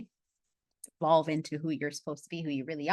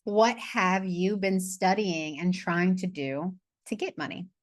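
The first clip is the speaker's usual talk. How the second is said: slow question; slower than usual. Shown as a demonstration. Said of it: "question read slowly".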